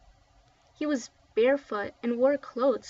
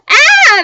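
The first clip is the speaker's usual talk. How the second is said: high, very loud shriek of fright; scream; 22 dB louder than usual. A narrator's reading. Kids terrified by the ghost's voice, screaming. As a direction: scream in terror. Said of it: "the kids screamed again".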